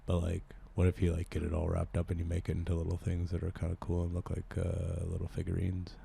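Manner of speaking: low-key, softly creepy oddball; odd sheltered loner. Acted. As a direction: keep the stranger quiet and subdued. Improv character on water slide.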